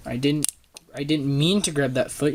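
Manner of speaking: ordinary delivery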